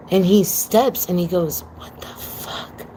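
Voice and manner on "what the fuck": a hushed, breathless whisper of disbelief; stunned whisper. Retold or imitated. Tommy off to the side of the FaceTime, shocked whisper. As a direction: whisper it so the caller can't hear.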